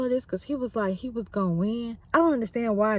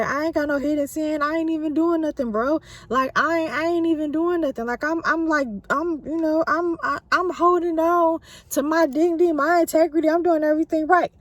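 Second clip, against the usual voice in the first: higher, protesting his innocence; indignant protest; 6 semitones higher, 4 dB louder than usual. Retold or imitated. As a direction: insist you did nothing wrong. Job defending himself to friends.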